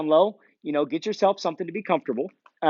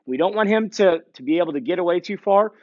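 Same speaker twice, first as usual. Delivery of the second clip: ordinary talk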